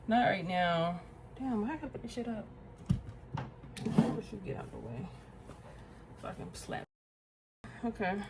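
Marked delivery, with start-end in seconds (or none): 1.4-6.8 whisper to self. Real, not acted. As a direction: breathe it to yourself while working. Whispering to herself mid-task.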